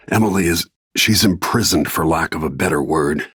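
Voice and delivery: ordinary delivery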